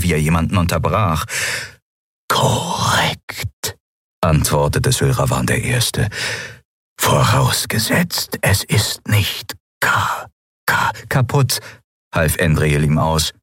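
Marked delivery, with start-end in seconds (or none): 2.3-3.8 whispered, slow, faltering machine voice. Acted. 7.0-11.6 higher, slow, stuttering machine voice that keeps glitching; failing machine voice. Acted.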